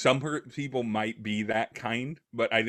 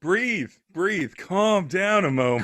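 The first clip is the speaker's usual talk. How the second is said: mock soothing; 9 semitones higher, 6 dB louder, slower than usual.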